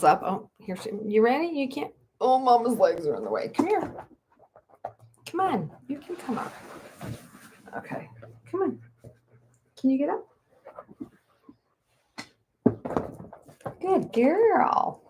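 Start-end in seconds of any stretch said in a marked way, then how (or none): 3.5-8.8 high, sweet pet talk. Real, not acted. Coaxing her dog onto lap. 9.8-15.0 squeaky praise for the dog; pet praise. Real, not acted.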